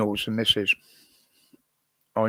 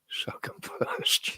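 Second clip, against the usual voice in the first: whispering; 7 dB quieter, slower than usual. Real, not acted. Joking about eating with bad teeth.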